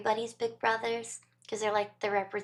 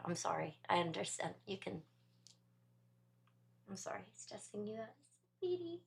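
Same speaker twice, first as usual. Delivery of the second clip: soothing; 2 semitones lower, 11 dB quieter than usual. Real, not acted. Apologising softly to stressed cat.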